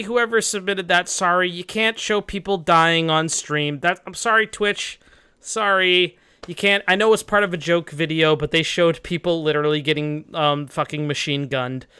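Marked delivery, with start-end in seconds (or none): none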